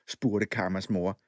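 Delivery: ordinary delivery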